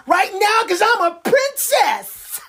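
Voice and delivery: whiny princess demand; bratty princess. Acted. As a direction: shout like an impatient spoiled princess. Voicing bratty little girl.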